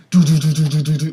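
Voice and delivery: rapid staccato gun noises; imitated gunfire. Gamer miming shotgun fire through the level, rapid mouth noises. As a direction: fire off the sounds like automatic shotguns.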